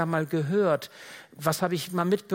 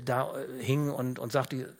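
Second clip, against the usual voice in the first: ordinary delivery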